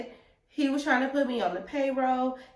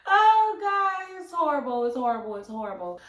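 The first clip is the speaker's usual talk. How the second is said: hammed-up disgust; 6 semitones higher, 6 dB louder than usual. Reliving how awful it was.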